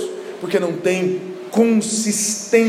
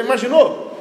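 ordinary talk, nothing marked

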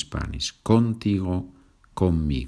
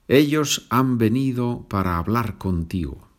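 normal talking voice

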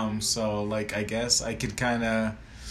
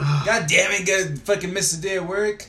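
higher-pitched griping; mock annoyed outburst; exasperated parent; 7 semitones higher than usual. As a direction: grumble as a put-out parent, hammed-up.

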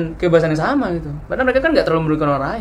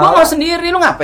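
higher and louder, exasperated; annoyed; 11 semitones higher, 6 dB louder than usual. Acted. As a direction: scold the friend for intruding. Host annoyed at intruder. (kesal, lebih tinggi dan keras)

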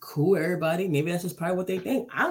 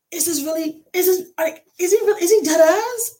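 high, rising incredulous questioning; retold alarmed disbelief; 11 semitones higher, 6 dB louder than usual. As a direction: act out a panicked inner voice, getting louder. Retold panicked thought.

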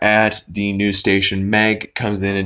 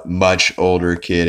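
ordinary talk, nothing marked

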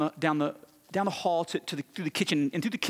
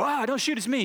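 high-pitched retold yelp of alarm; comic panicked plea to hold fire; mock panic; 8 semitones higher than usual. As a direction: blurt a startled plea, much higher, played for laughs. Panicked plea to armed wife, retold.

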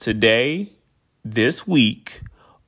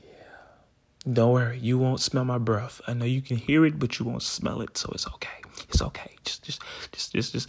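hushed whisper between breaths; 7 dB quieter than usual. Real, not acted. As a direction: keep it hushed and close. Whispered asides during breathing.

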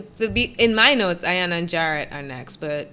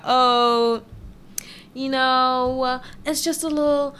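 a light, awkward Mallory impression, softer and trailing; imitating Mallory; 5 semitones higher, 3 dB quieter than usual. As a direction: mimic her breathy, awkward dating talk. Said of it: "that's exactly how she sounds".

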